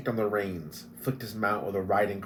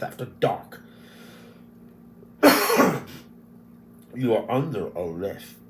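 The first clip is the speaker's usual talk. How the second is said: pompous hobgoblin; 4 dB louder than usual. Acted. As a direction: puff up like a petty official.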